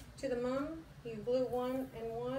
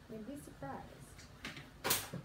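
slow confiding whisper; 7 dB quieter, much slower than usual. Real, not acted. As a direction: whisper it as a secret. Confiding aside to viewers.

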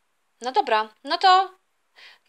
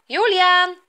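loud, raised summons; calling out; 5 semitones higher, 6 dB louder than usual. Acted. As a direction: shout the son's name to get attention. Parent calls son again.

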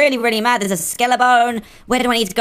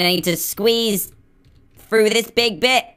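ordinary talk

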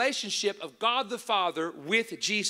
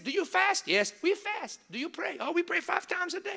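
put-on Middle Eastern accent; 9 semitones higher than usual. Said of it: "I gotta do the accent".

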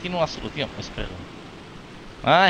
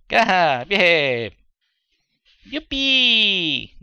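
high, loud yay; delighted cheer; 6 semitones higher, 3 dB louder, much slower than usual. Real, not acted. Player cheering when the crashed game comes back, high and loud. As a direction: celebrate the game working again.

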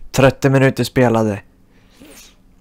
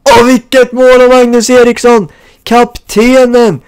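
higher, louder, slow hammed-up goal call; mock sports commentator; 8 semitones higher, 8 dB louder, slower than usual.

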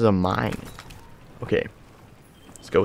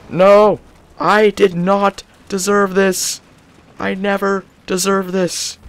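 higher and louder, slow outraged cry; dragged-out shouted complaint; loud anguished protest; 9 semitones higher, 5 dB louder, slower than usual. Real, not acted. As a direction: wail at the unfair death. Dying in game, protesting.

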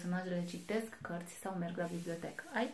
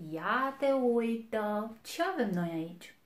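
big bad wolf; 2 semitones higher, 7 dB louder than usual. A narrator's reading. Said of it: "a spus lupul cel mare și rău".

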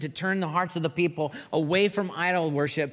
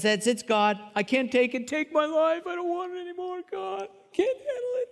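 high, whining plea, trailing off; whiny despair; 13 semitones higher than usual. Retold or imitated. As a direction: wail like a man at the end of his rope. Elijah voiced despairing, suicidal.

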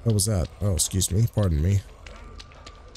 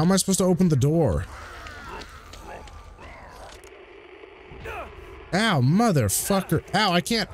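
panicking gamer; 8 semitones higher, 3 dB quieter than usual. Real, not acted. Cornered by zombies, getting hit.

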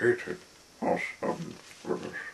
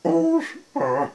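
very high, loud little squeak; sad, squeaky voice; 11 dB louder than usual. A narrator's reading. Unseen figure answers a knock at the door, sad squeak. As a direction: squeak it out pitifully. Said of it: "little sad and a little squeaky sort of voice".

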